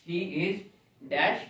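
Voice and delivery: he is dash drawn out very slowly; slow dictation. Shown as a demonstration. Teacher reading the blank sentence slowly to students. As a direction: spell it out slowly for the class. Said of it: "teacher dictating sentence slowly".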